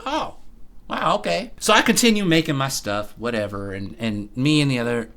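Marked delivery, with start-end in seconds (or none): 0.1-1.5 surprised coworker. Retold or imitated. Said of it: "She's like".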